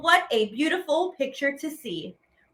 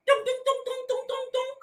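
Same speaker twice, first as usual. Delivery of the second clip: high, sing-song dunk dunk dunk; high knocking sounds; 11 semitones higher, 4 dB quieter, faster than usual. Acted. Narrator imitates high knocking sounds. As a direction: tap out the knocks in a much higher voice.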